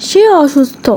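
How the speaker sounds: ordinary delivery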